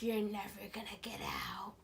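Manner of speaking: eerie unvoiced whisper; sinister dream voice. Mysterious voice taunting the trapped dreamer, sinister whisper. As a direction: whisper it as a menacing phantom voice. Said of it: "And I heard a voice".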